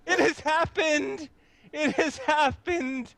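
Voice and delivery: high, drawn-out rapture; high-pitched, awestruck exultation; ecstatic joy. Acted. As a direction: proclaim it slowly, overwhelmed with joy. Ecstatic devotee, weeping in joy.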